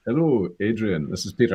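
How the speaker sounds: imitation of a Scottish actor's taped greeting; Peter Capaldi. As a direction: introduce yourself in a put-on Scots voice. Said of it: "and I heard".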